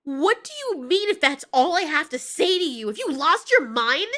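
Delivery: loud incredulous yelling; outraged tone. Acted. Mothra snaps at Godzilla's cold question, outraged. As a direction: throw the question back at full indignation. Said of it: "Please watch your tone".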